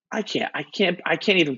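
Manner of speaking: high, flustered stammer; overwhelmed disbelief. Retold or imitated. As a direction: repeat it, too rattled to finish. Overwhelmed by news of dad.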